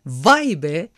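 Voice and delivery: cheerful shout. Acted. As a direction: call out happily, lightly voiced. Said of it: "diye neşeyle bağırdı Dean". (neşeyle hafif bir bağırış)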